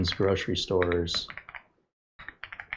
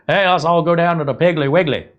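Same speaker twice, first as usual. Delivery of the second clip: imitating a Southern accent, raised and louder; 8 semitones higher, 7 dB louder than usual. Imagined Southern shopper inviting everyone, Southern accent. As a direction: play a Southern shopper calling friends in a Southern accent. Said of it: "gonna have a Southern accent".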